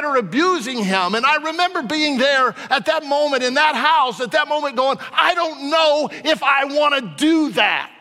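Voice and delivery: impassioned preaching. Acted. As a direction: pour it all out to the crowd. Preacher relives the cross.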